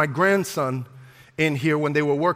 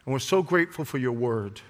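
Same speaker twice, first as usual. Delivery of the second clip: soft, hushed opening prayer; 5 semitones lower, 6 dB quieter than usual. Acted. Pastor praying softly over congregation, hushed. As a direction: pray it quietly to God.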